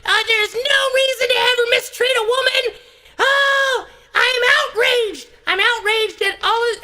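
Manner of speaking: very high squawking falsetto; hammed-up cartoon bird outrage; outraged chirping bird. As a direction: chirp furious indignation like a tiny bird. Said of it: "the birds just communicate and chirp".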